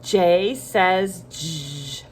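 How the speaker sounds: slow "jay says jzhh" for J says j; name J, then hold j as a buzz; letter then sound. Shown as a demonstration. Teacher has children repeat, slow J says j with buzzed sound. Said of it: "Say it again".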